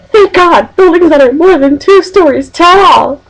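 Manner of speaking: high, drawn-out mock relief; imitating a friend's text. Friend texts on reaching campus, hammed-up relief. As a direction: play up the relief, pitched high and slow. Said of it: "he goes".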